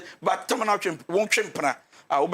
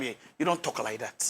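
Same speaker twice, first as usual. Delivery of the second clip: stern rebuke; 6 semitones lower, 6 dB quieter, slower than usual. Real, not acted. Scolding a rude wife.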